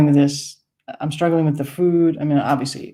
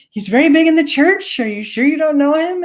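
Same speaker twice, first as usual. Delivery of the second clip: high, louder, pressing question; high insisting old lady; 12 semitones higher, 4 dB louder than usual. Retold or imitated. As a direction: press her hopefully again. Woman insists.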